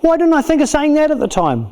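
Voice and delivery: high-pitched mock exasperation; acted after-the-fact frustration; sudden regret. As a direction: kick yourself for the missed comeback. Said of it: "all of a sudden you go".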